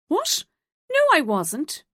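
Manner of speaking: a sudden high squeak of shock, then a loud denial. A narrator's reading. Joan accused of sleeping with Leo, shocked high denial. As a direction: caught out and flustered. Caught out, denying.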